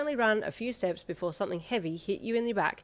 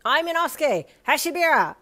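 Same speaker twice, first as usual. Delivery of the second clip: high, loud proud announcement; a brash boar-masked boy naming himself; boastful boar boy; 8 semitones higher, 10 dB louder than usual. A narrator's reading. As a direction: announce the name like a title. Boar character introduces himself.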